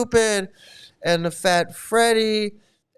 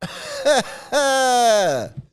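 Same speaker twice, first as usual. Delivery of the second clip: laughing hard; 4 semitones higher than usual. Real, not acted. Mocking the clip's phrase.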